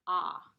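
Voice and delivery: a loud clear "ah" short vowel; spelled sound. Shown as a demonstration. Teacher contrasting the spelled vowel "ah". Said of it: "it's actually spelled with a".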